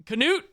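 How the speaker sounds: "Canute?" in two syllables, lifted high as a question; sounded K. Shown as a demonstration. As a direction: try "Canute" with the K pronounced. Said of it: "Or is it just Newt?".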